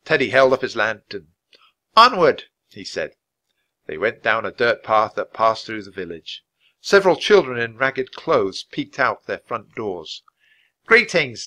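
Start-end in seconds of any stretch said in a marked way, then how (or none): 2.0-2.5 loud, high marching order; rallying call. A narrator's reading. Leader urges the group on. 10.9-11.5 raised, higher hailing voice; calling out. A narrator's reading. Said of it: "Teddy called".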